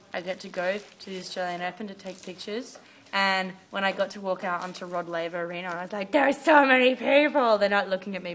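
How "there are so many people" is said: amazed. Retold or imitated. Awed on Rod Laver Arena.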